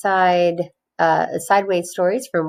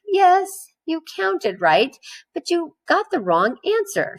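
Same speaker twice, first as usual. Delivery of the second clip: frustrated teacher; 10 semitones higher than usual. A narrator's reading.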